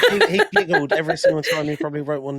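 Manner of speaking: fast, loud, high words bubbling with real laughter; giggling as he talks it out; laughing through it. Real, not acted. Co-host cracking up over the list of banned words. Laughing while telling the joke.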